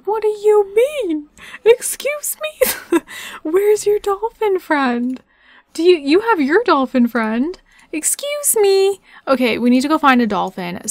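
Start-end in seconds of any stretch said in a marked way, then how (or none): 0.1-9.0 high, drawn-out, squeaky-pitched questioning; playful mock disbelief. Player quizzing game character about the missing dolphin friend, high mock disbelief. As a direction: coo it high and incredulous, stretching the words.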